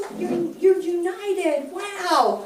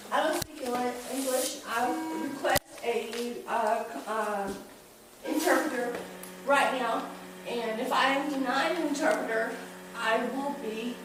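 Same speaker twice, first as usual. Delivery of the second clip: quiet feigned helplessness; 2 semitones lower, 10 dB quieter than usual. Pretend. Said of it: "forgets English".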